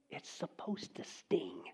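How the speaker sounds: slow whispered warning; hushed and slow. Real, not acted. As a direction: let it sting in a whisper.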